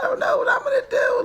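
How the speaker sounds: high fast mock wail of despair. Mother imagining herself in tears, hammed-up wail. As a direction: throw out a panicked mock sob-shout. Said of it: "sit here in tears like".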